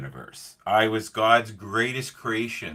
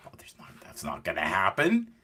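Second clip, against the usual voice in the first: half-whispered inner denial; imitated private muttering, breaking out of a whisper; muttered disbelief; 4 dB quieter than usual. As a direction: whisper it to yourself in refusal. Young self's private disbelief.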